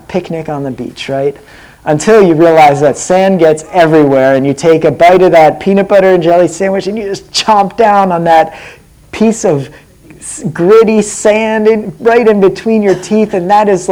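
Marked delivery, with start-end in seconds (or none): 4.4-13.9 disgusted recall. Retold or imitated. Recalling gritty sand bite.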